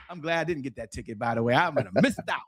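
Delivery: ordinary talk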